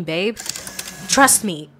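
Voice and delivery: normal talking voice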